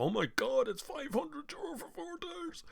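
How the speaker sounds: high shocked squeal, slowing down; mock shock. Buyer seeing tyre prices, mock shocked squeal. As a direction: act out the sticker shock.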